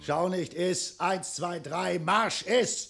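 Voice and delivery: loud barking command; barked orders. Acted. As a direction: drill him like a sergeant. Dragon orders guest to eat.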